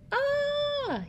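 very high, held dinosaur scream; mock scream. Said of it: "I'm, I'm screaming".

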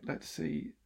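ordinary delivery

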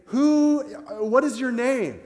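high, slow, strained plea; pleading Moses. Retold or imitated. Moses asks God his name at the bush, high and strained. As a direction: Moses begging God, voice climbing.

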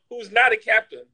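ordinary talk, nothing marked

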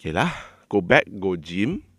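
lazy unbothered drawl. Acted. Voicing a relaxed politician.

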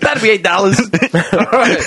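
cracking up. Real, not acted. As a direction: barely hold the joke together. Cracks up over dinner riff.

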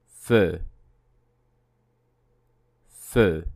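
Feu with a closed rounded vowel; model word. Shown as a demonstration. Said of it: "the word meaning fire".

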